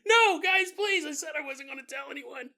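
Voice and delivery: high panicked pleading; pleading father. Acted. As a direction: beg them to let him go, voice cracking high. Father begging while kidnapped.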